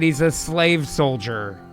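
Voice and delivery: ordinary talk, nothing marked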